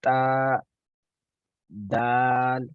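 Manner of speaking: ता and दाल said crisply with the tongue tip on the upper teeth; letter demo. Shown as a demonstration. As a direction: tap out ता then दाल. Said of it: "तो देखें". (ता और दाल का उच्चारण)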